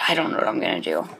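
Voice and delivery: low and quick, like a put-on gruff guy; deep fast mock voice. Gamer muttering in a low put-on voice about his failed crops.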